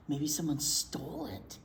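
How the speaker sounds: ordinary talk, nothing marked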